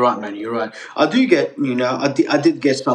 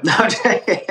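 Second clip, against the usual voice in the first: higher, laughing retraction; 7 semitones higher than usual. Real, not acted. Guest laughs off his sponsorship plea, chuckling. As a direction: laugh it off as a joke. Takes back sponsorship joke.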